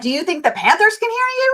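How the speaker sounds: high-pitched mocking echo of the question; retold sassy comeback. Wife to Panthers-fan husband, sassy comeback.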